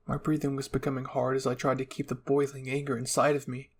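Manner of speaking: plain reading voice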